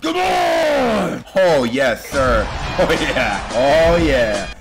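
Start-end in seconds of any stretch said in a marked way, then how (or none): none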